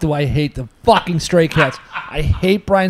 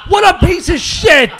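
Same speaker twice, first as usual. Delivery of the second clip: high, shouted disgust, words drawn out; outraged rant; 10 semitones higher, 3 dB louder than usual. Real, not acted. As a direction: explode at how bad the lyrics are. Ranting at the song's lyrics.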